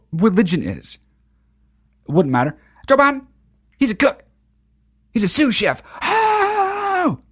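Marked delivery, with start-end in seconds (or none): 2.9-5.8 high-pitched preacher accusation parody; mocking preacher. Preacher accusing Biden before his flock, mock sermon. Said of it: "his churchgoers will freak out". 6.0-7.2 very high, sustained falsetto "oh"; imitated crowd swoon, held long; mock congregation wail. Churchgoers reacting to the preacher, mock rapture. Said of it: "his churchgoers will freak out".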